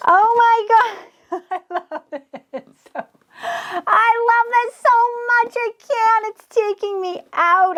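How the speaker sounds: high, squealing delight; overjoyed gushing. Real, not acted. Crafter gushing over her new clock, squealing delight. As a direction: gush like you can't contain it. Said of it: "I'm getting way too excited".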